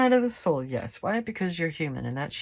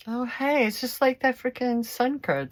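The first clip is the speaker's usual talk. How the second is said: excited; 8 semitones higher, 5 dB louder than usual. Real, not acted. Sudden realisation, higher, louder.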